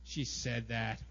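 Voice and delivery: low, subdued brooding; sit on the grudge, quietly; understated resentful grumble; stewing anger. Acted. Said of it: "you just let it sit there".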